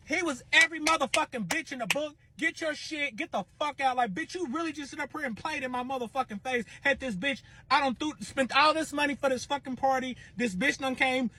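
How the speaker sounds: high, outraged rant; venting fury at the betrayal. Real, not acted. Furious rant after betrayal.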